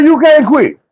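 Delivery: high insistent coach. Retold or imitated. Coach refuses to let him quit, high and firm. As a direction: forbid him firmly. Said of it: "He said".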